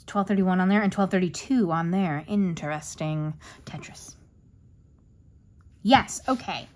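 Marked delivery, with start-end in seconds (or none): 3.6-4.1 whisper. Real, not acted. Flip-through, whispering aside.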